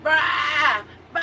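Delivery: high loud bleating; goat bleat. Retold or imitated. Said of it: "It's like".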